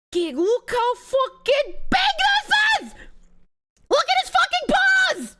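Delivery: high, loud squeal of delight; excited shriek. Real, not acted. To chat over a huge cat photo, high excited shriek.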